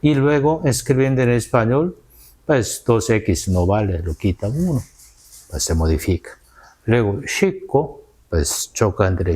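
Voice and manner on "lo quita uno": normal talking voice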